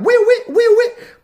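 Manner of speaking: very high whooping siren; police siren imitation. Cops pulling tourists over on the freeway, siren noise. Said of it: "pulled over by the cops".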